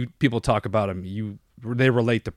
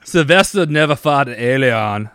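doing a playful Austrian action star; Arnold Austrian accent; 4 dB louder than usual. Retold or imitated. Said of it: "the worst Austrian accent".

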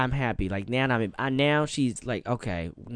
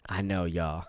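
ordinary talk, nothing marked

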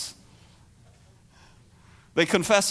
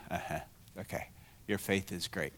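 flat unimpressed; 18 dB quieter than usual. Pretend. You're all sitting there.

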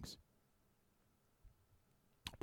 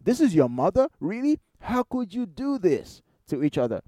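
high-pitched, incredulous questioning; appalled disbelief; 5 semitones higher than usual. Retold or imitated. Preacher reacting to talk-show family scandal, incredulous. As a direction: question them as if you can't believe it. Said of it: "I go, I'm like".

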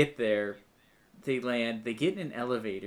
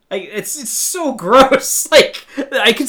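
laughing; 10 semitones higher, 15 dB louder, slower than usual. Real, not acted. Laughing at gross scene.

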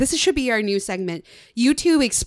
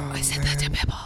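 hushed, breathy aside; whisper; 7 semitones lower, 6 dB quieter, faster than usual. Real, not acted. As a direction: whisper it in dismay. Horrified aside after gasp.

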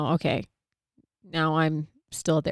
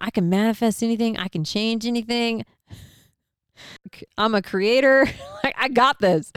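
giddy confidence; 5 semitones higher than usual. Retold or imitated. Hyping herself up, laughing.

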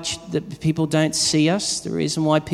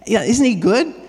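excited praise; 10 semitones higher, 5 dB louder than usual. Retold or imitated. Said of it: "we're pointing at him".

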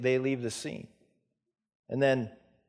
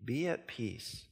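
soft reassurance; 6 dB quieter, slower than usual. Acted. Preacher repeats gently.

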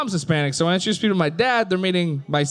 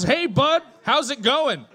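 dad's greeting; 7 semitones higher, slower than usual. Retold or imitated. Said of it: "he rolls up and he goes".

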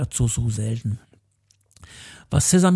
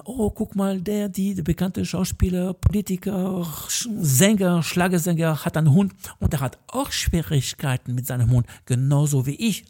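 higher, gushing TV viewer voice; imitating excited viewer; 5 semitones higher than usual. Viewer marvelling at a celebrity's dog troubles, higher and gushing. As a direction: play a starstruck fan pointing at the screen. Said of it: "Wir können schauen".

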